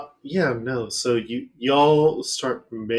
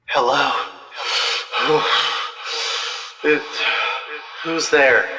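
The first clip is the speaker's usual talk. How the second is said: echoing ghostly voice, louder; cool echo effect; 4 semitones higher, 4 dB louder than usual. Acted. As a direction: voice calling through an echo. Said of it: "cool echo effect on this voice".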